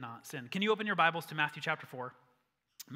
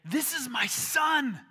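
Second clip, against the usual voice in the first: high, loud, slow shout; full-force divine announcement; booming God's voice; 9 semitones higher, 8 dB louder, slower than usual. Acted. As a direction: shout it proudly from the heavens. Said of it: "God's voice came booming out".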